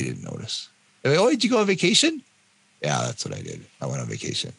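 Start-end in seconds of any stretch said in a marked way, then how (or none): 1.2-2.2 high, fast, oblivious question; clueless family. Retold or imitated. Mimics clueless family asking. 2.8-4.5 low, soft, deadpan reply; resigned sarcasm. Retold or imitated.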